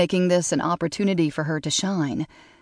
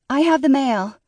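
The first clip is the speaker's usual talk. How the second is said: high, light intern voice; chirpy young assistant; 9 semitones higher, 4 dB louder than usual. A narrator's reading. Intern announces mail.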